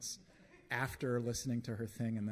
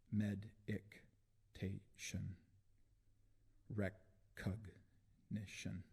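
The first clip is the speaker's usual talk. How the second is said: drawn-out list split syllable by syllable; slow, broken syllables; 4 dB quieter, much slower than usual. Acted. Poet repeating the prisoner's demands, slow and syllable by syllable. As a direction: slowly break each word apart with gaps.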